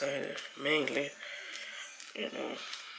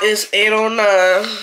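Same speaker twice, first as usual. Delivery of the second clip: emphatic repeat; 3 semitones higher, 9 dB louder, slower than usual. Real, not acted. Repeats time after being ignored.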